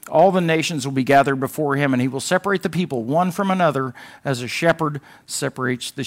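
proclaiming the words of Jesus; scripture reading. Acted. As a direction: read the Gospel to the congregation.

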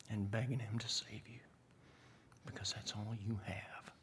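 soft near-whisper with long pauses; quiet, intimate pulpit plea; hushed preaching. Real, not acted. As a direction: let each phrase fall away into a whisper.

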